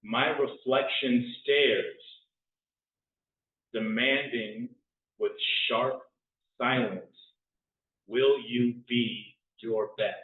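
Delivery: slow, spare haiku reading; hushed sparse haiku. Acted. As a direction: let each haiku line hang. Reads another haiku.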